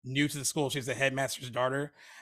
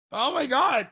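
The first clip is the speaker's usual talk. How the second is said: surprised; 10 semitones higher, 7 dB louder, slower than usual. Real, not acted. Guessing a movie tagline, stumped.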